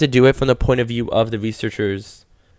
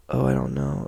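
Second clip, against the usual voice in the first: queasy whisper; 8 dB quieter than usual. Retold or imitated. Acting out feeling sick.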